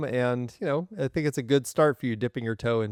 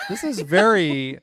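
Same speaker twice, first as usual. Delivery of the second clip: laughing; 5 semitones higher, 5 dB louder, slower than usual. Real, not acted.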